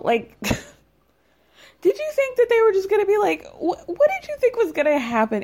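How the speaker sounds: high, laughing disbelief, slowed down; scoffing at Erica with a laugh; incredulous scoff. Real, not acted. Recapper mocking Erica's naivety, laughing in disbelief. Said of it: "yeah, girl".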